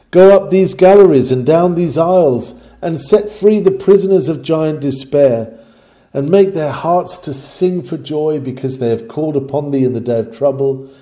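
loud pleading sermon climax; fervent preaching. Acted. Preacher begging the master to free mourners, loud and fervent. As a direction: beseech the master with rising fervour.

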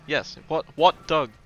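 loud two-sided mock argument; hammed-up bickering, punched words; acted exasperated exchange. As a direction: play both sides, getting heated. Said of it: "for example".

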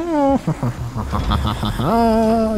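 short amused laugh. Real, not acted. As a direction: burst out laughing.

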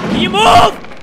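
high, loud, impatient shout; exasperated yell. Real, not acted. Player to slow companion, exasperated yell. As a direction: yell at a partner blocking you.

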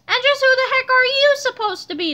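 high, loud, indignant question; angry demand. A narrator's reading. Police chief confronting Captain Underpants, demanding angrily. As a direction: demand an answer. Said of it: "the police chief demanded".

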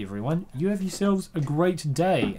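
ordinary talk, nothing marked

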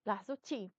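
usual speaking voice

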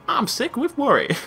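bad southern accent. Fishery owner worried about her boys, hammed-up high southern accent. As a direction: stretch it out in a badly faked southern voice. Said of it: "they're, like, really badly southern".

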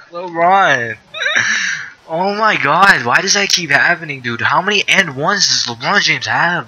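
high, loud laughing outburst of disbelief; laughing disbelief shout. Real, not acted. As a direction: yell at the screen, half laughing. Opponent scores and-one again.

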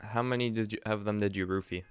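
normal talking voice

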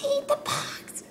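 slow, high pleading whisper; high whispered urging. Real, not acted. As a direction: urge it on under your breath.

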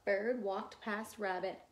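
plain reading voice

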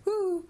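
high-pitched "woo" after laughing; relieved little woo, way up high; high whoop. Real, not acted.

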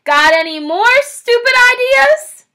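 high, loud sneering shout; shouting back. Acted. Bunce shouting back at his partner in scorn. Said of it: "Bunce shouted back".

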